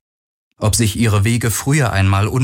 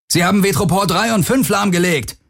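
high, urgent call over noise; panicky call; 10 semitones higher than usual. A narrator's reading. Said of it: "rief Akina über den Lärm". (mit einem Hauch von Panik gerufen)

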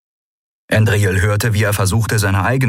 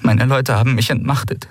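urgent, higher-pitched plea; desperate woman's warning; 5 semitones higher than usual. Acted. Deposed woman warning her captors of the monster, urgent and desperate. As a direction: pour out the bad news desperately.

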